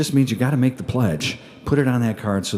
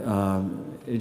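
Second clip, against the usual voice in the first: ordinary delivery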